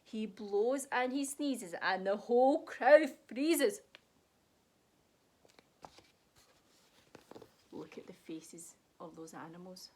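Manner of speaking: lively, sing-song picture book read; reading to children. A narrator's reading. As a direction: read it like a bedtime story for kids. Reads picture book aloud.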